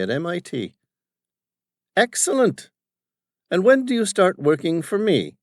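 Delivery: put-on high, loud, bossy old businessman; domineering father. Father questioning his son's crew, bossy and loud. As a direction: voice the overbearing father barking at his staff.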